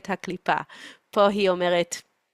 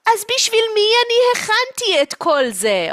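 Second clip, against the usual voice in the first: high-pitched, loud protest; aggrieved mother; 7 dB louder than usual. Acted. Mother asking who she cooked all this for, aggrieved. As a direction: demand an answer, voice shooting up.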